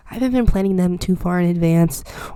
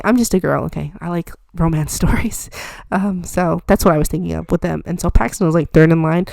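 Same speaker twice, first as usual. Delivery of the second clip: ordinary delivery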